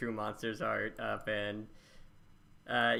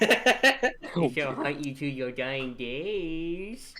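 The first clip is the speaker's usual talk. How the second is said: slow, eerie haunting voice; drawn-out mock ghost threat; spooky ghost voice; 2 semitones higher, 4 dB louder, much slower than usual. Mock threat after uwu joke.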